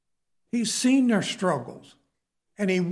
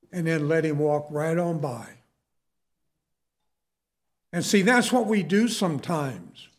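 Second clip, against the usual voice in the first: usual speaking voice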